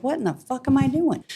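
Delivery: high-pitched, incredulous self-questioning; retold disbelief. Retold sudden alarm at own choice.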